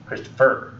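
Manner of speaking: Christopher as christo-FUR; wrongly stressed Christopher, weight at the end on PHER; stress on last syllable. Shown as a demonstration. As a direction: lean on the final syllable of Christopher. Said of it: "changing around where I put the stress".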